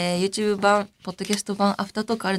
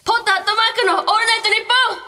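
mock title call; 14 semitones higher, 6 dB louder, faster than usual. Host jokingly turns an email address into a show title, high mock shouting. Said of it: "こういうことか。".

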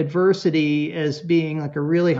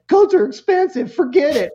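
put-on much higher and louder, kid giving up; sulky kid outburst; 10 semitones higher, 6 dB louder than usual. Son refusing to buy a coat himself, high sulky outburst. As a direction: fling it out like a huffy teen.

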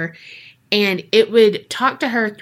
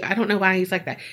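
plain reading voice

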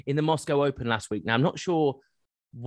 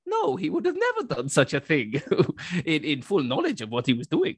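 put-on a lightly held Russian accent breaking into a laugh. Mocking the organiser for his co-host in a light Russian accent. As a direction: keep the mock Russian accent going, mildly. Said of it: "I'll do it in a Russian voice".

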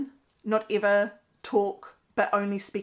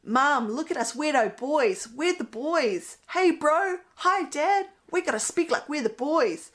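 mock boy voice; 6 semitones higher, 4 dB louder than usual. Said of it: "talking in a deep voice".

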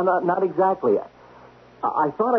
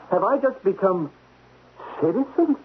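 shocked disbelief; 7 semitones higher than usual. Acted. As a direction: echo the demotion in stunned panic. Demoted to citizen, shocked.

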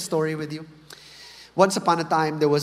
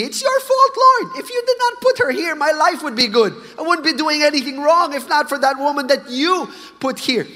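much higher, louder, whining complaint; whiny blaming Adam; 9 semitones higher, 5 dB louder than usual. Acted. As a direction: whine the blame onto God like a sulking man. Adam acted blaming God.

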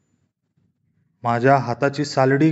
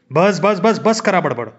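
dad shouting; 4 semitones higher, slower than usual. A narrator's reading. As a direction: cut them off with a shout. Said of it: "पप्पा ओरडले".

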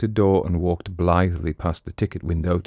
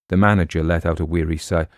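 ordinary delivery, nothing marked

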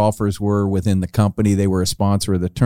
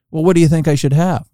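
lightly snooty retort, pitched higher; a little snooty; 7 semitones higher than usual. Retold or imitated. Golfer asks his caddy back, mildly snooty. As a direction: imitate a mildly huffy golfer, pitch lifted. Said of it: "And he gets a little snooty".

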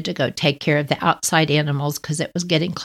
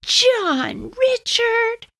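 much higher, slow, stretched full-name summons; stern mother calling; 14 semitones higher, much slower than usual. Retold or imitated. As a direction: mom calls him in, no arguing. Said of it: "mother summons son by full name".

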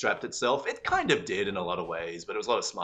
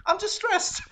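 laughing; 6 dB louder, slower than usual. Retold or imitated. Retelling her own stress spiral.

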